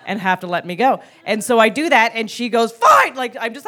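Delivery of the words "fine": high, loud snapped surrender; sudden mock-furious outburst, pitched way up; exasperated yell. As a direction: imitate a mother giving in at a shout. Mother giving in to tantrum.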